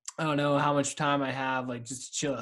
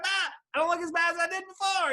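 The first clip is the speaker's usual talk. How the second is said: mock shout; 13 semitones higher than usual. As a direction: belt out the self-reassurance. Acted quote, shout measured.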